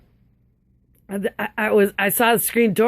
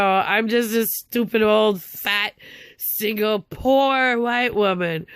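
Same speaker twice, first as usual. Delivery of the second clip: slow, higher, drawn-out sarcasm; bitter self-mockery; 3 semitones higher, slower than usual. Real, not acted. Woman running herself down after hang-ups, bitterly self-mocking. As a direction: drag out each word, mocking yourself.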